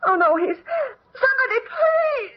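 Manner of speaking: high, sobbing cries of panic; crying out, near hysterics; hysterical crying. Acted. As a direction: wail in distress over the body. Finds the dead butler.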